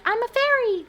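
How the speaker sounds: high, mock-airy voice of a costumed adult; mocking partygoer. Mocking adults in skimpy Halloween costumes, high mock voice. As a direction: sneer at the fairy costume. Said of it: "being like".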